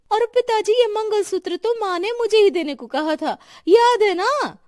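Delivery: loud, very high-pitched demand; insistent greedy claim. Acted. Daughter-in-law claiming the mangalsutra from father-in-law, shrill insistence. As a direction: stake your claim before anyone else can.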